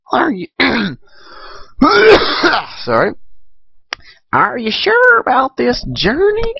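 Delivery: high, creaky old granny voice, badly done; a clumsy old grandma impression; Granny Smith voice. Old granny asking Applejack about her journey, high quavering. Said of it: "that is the worst Granny Smith voice ever".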